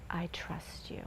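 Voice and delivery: soft whispered trust; whispered prayer. Retold or imitated. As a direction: breathe it like a child's secret prayer. Child's quiet prayer retold.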